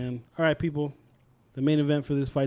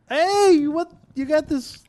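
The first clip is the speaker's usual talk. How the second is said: surprised; 11 semitones higher, 4 dB louder, much slower than usual. Retold or imitated. Retelling surprise at the sweater.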